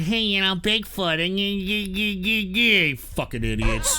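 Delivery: stammering dumb troll impression; mocking stutter. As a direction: mimic a stuttering idiot, mocking.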